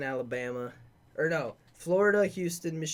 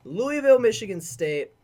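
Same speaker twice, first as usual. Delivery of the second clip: higher, louder announcement of the name; excited call-out; 7 semitones higher, 9 dB louder than usual. Real, not acted. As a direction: call it out like a big game. Announcing the next matchup.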